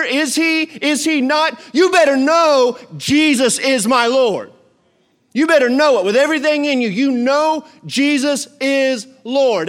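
high, loud and slow, pressing the question; fervent preaching. Acted. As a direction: drive it home to the crowd. Urging certainty of faith.

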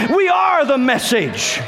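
high, drawn-out preacher's shout; insistent repeat. Real, not acted. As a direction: shout it slowly so they really hear. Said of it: "Oh, you didn't hear me".

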